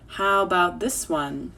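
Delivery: ordinary delivery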